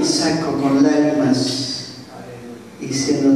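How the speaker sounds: ordinary talk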